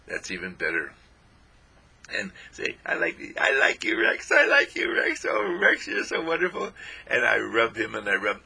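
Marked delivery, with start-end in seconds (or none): 2.8-6.7 high, sing-song pet talk; gushing over Rex in a high, cooing voice. Real, not acted. Talking sweetly to his dog.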